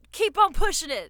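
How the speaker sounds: loud, high hype shout; hype coach voice. Retold or imitated. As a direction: pump it up like a trainer. Quoting the coach line.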